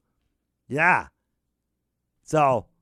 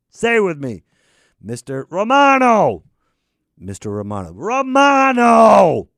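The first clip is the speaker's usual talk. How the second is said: louder, laying down the law; stern demand; 6 dB louder than usual. Acted. Demanding the host call him Mister Romano, stern and loud. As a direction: drill it into him.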